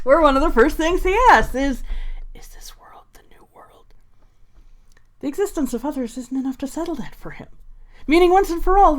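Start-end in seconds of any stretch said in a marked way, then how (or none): 2.3-3.9 hushed, whispered villain quote; Cyrus voice. Acted. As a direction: whisper it like a brooding edgelord. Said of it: "hearing my Cyrus voice".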